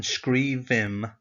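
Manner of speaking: skrivim said slowly, each syllable spelled out clearly; slow teaching demo. Shown as a demonstration. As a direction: draw out skrivim for learners. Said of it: "have a look for yourself at the difference".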